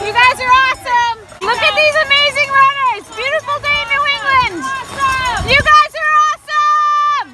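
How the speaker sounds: cheering. Real, not acted. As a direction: keep up a stream of cheers. Cheering runners passing by.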